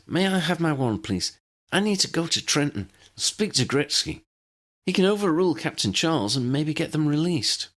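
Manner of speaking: lightly voiced anxious agent; young woman agent. Tally voiced higher.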